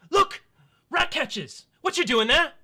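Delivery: put-on high, loud excited kid calling out; excited child. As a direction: shout like a thrilled little boy. GM voices elven children.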